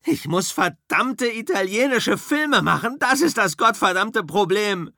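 high, loud furious shout; yelling. Acted. Rick yells at Cliff about Italian films, furious shout. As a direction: bellow it at your driver. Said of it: "Rick schreit".

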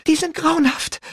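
breathless, horrified gasp. A narrator's reading. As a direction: gasp it out in horror. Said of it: "keuchte Rachel".

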